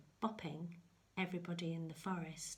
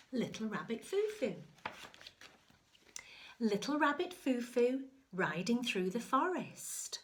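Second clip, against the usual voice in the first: story reading; 4 semitones higher, 6 dB louder than usual. Acted.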